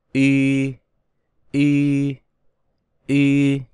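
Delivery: long, level Y as ü, lips rounded and unmoving; lips held in place. Shown as a demonstration. Teacher models the correct vowel, Y held without moving the lips. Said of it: "Chúng ta bắt đầu đọc nào".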